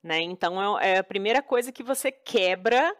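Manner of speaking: normal talking voice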